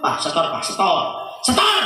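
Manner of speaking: wife demanding money, then shouting; nagging wife. Retold or imitated. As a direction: push until it bursts out.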